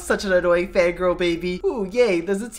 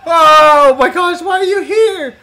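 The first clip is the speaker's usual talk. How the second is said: very high, loud shriek; drawn-out shriek of disbelief; shocked scream; 7 semitones higher, 9 dB louder, slower than usual. Acted. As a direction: scream the question in alarm. Attacker appears suddenly.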